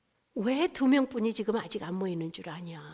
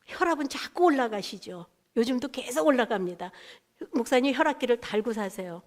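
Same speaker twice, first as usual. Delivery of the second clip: ordinary talk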